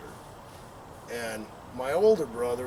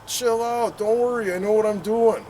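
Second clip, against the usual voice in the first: put-on higher, louder cocky kid brother; cocky brush-off; 7 semitones higher, 7 dB louder than usual. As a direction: toss it off like a know-it-all younger brother. Brother voiced brushing off warnings.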